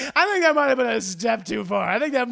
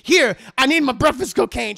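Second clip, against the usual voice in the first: louder, fast insistent need; loud whining demand; 5 semitones higher, 6 dB louder than usual. Retold or imitated. Harley demanding his stash from his girlfriend, loud. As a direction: insist like a desperate addict. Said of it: "He's like".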